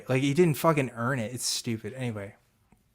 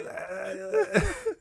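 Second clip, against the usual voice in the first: high laugh; 8 dB quieter than usual. Real, not acted. Cracks up again.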